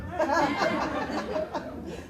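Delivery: a soft, high rising laugh. Real, not acted. Preacher laughing quietly at the praying wife.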